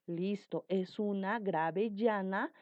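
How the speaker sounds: ordinary talk, nothing marked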